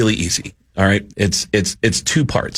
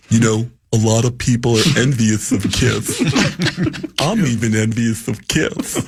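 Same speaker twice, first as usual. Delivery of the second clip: [name] impression. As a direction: purr it like a preening rock frontman. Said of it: "my [name] impression".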